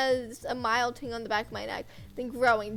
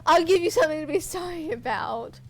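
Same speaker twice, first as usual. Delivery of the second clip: loud, higher, snarling threat; menacing threat; 6 semitones higher, 6 dB louder than usual. Acted. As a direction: threaten him with venom. Villain threatens captive.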